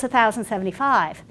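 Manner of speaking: normal talking voice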